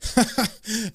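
a short burst of amused laughter; retold laugh. John laughing with his old friend Kit, warm chuckle. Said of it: "he starts laughing".

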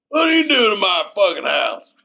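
high loud outraged shout; angry dad yell. Retold or imitated. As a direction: bellow at an intruder. Listener riffs on dad yell.

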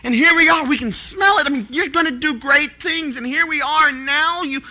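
a desperate plea to a silent God; desperate prayer. Retold or imitated. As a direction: plead loudly with God, near breaking. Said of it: "We had felt like".

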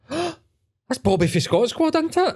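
high, slow, breathless fan voice; excited fan. Retold or imitated. Guest imitating a starstruck woman spotting the logo. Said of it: "turned around and went".

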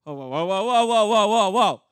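very loud run of whoas, building; alarmed objection. Pretend. Preacher interrupting the startling scripture, mock alarm. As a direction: halt everything, mock-flabbergasted.

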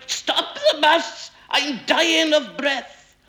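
high, slow, strangled wail; goatish cry. A narrator's reading. Mister Weasley begs to stop at every pub, crying out. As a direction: bleat it out high and drawn-out. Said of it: "would cough like a billy goat and cry".

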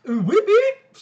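high, loud mock siren wail; police siren imitation. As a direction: whoop it out like a cop siren. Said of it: "sounds his fucking".

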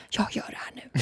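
whispering her plan to cheat unseen; sneaky whisper. Retold or imitated. Secretly deciding to cheat.

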